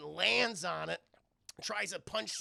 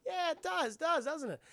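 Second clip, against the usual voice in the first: put-on much higher, bright kid squeal; excited kid; 11 semitones higher, 5 dB louder than usual. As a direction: Dick thrilled about the game. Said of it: "He's like".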